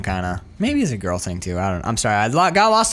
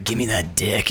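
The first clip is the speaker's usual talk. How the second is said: low, soft mock come-on; demanding seductress; 10 semitones lower, 5 dB quieter than usual. Retelling her walking upstairs to him, low and soft. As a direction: drop the voice to a quiet, comic demand. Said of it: "she walks upstairs and she's like".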